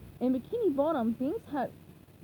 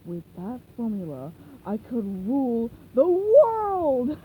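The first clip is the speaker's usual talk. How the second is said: megalomaniac plankton; evil villain; 7 dB louder, slower than usual. Acted. Plankton plotting aside.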